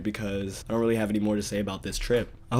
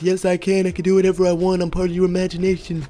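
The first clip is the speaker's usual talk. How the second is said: high, louder smug squeak; pitched-up little voice, full of himself; smug tiny wizard; 8 semitones higher, 6 dB louder than usual. Acted. Imaginary wizard brags to the narrator, high and smug. Mini Gandalf retorts.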